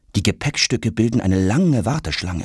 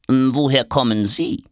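mildly curious inquiry, raised pitch; 5 semitones higher than usual. A narrator's reading. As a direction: ask with polite curiosity. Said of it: "erkundigt sich die Diplomatentasche neugierig". (neugierig fragend)

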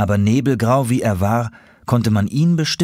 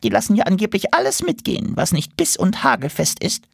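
much higher, fast chattering voice; fussy squirrel; 13 semitones higher than usual. A narrator's reading. Squirrel warning about the circus, fast and fussy. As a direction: rattle it off like a nervous squirrel.

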